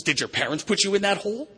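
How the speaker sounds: hammed-up probing, high and loud; mock analyst's question climbing very high at the end; psychiatrist. Psychiatrist probes the man in the hole about parents, high loud mock probing. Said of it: "And the psychiatrist says".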